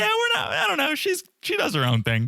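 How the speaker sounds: squeaky evasive producer impression; mocking high dodge. Mocking producers dodging the question, high and evasive. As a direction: pitch it way up, all fake innocence.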